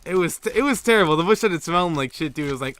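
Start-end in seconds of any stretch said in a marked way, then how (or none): none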